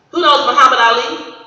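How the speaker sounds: calling out. Real, not acted. Speaker calls out to the audience.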